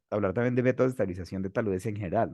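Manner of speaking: ordinary delivery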